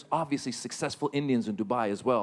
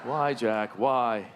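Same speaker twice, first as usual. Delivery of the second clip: mock lament; 5 semitones lower, much slower than usual.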